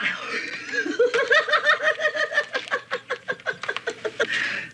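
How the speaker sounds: long, very high maniacal cackle. Acted. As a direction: cackle on and on in triumph. Long villain laugh.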